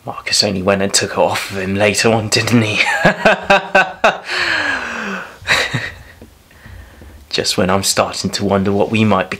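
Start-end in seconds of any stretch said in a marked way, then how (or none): 2.9-5.9 high, drawn-out burst of laughter; hearty high-pitched laugh. Acted. Amused at stolen tunic story.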